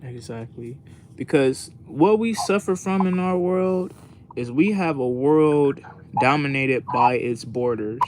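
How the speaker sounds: ordinary delivery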